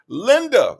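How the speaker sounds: high, loud playful name call. Pretend. Calling out a viewer's name.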